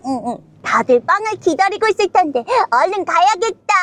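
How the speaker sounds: high, loud cartoon character voice; Cream Panda voice. Shown as a demonstration. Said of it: "저는 크림 판다를 하고 있습니다".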